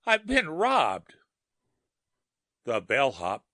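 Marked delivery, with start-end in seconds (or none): 0.1-1.2 despairing. A narrator's reading. Repeated in despair.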